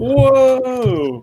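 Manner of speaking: high, stretched-out amazed whoa; a long, high gasp of awe; mock stunned whoa. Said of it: "I'm like".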